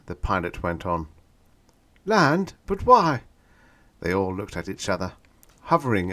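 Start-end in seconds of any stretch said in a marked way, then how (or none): 2.1-3.2 high, loud startled voice; alarmed question. A narrator's reading. Passenger alarmed at the landing order, startled. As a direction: blurt it in alarm.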